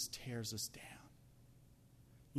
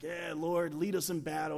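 Joshua; 4 semitones higher, 6 dB louder than usual. Acted. Acts Joshua eager for battle.

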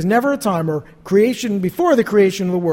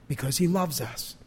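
softer, lower, letting it sink in; hushed repeat; 7 semitones lower, 9 dB quieter than usual. Real, not acted. Preacher repeating God's reason to the congregation, hushed. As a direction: drop the voice and say it tenderly. Repeats the phrase softly for effect.

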